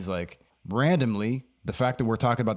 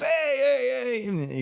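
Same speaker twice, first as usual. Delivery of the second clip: delighted hey; much slower than usual. Retold or imitated. Stone Man hugging him, high excited greeting. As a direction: gush hello over and over.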